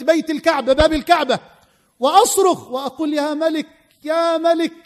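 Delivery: overjoyed cry of the healed man; excited. Retold or imitated. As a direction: shout it in disbelieving joy. Healed man stands and shouts.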